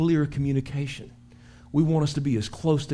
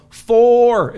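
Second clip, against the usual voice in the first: loud, higher "Four" shouted out; yelled warning; 8 semitones higher, 10 dB louder than usual. Shown as a demonstration. Pastor demos the golf warning call, yelled. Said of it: "you yell".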